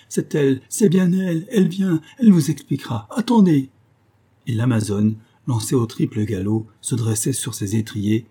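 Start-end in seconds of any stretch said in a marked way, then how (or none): 0.1-3.7 high, loud cries of desperate relief; clamouring. A narrator's reading. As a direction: cry out urgently to save your neck. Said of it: "put respirer et clamer". (clameur aiguë et affolée)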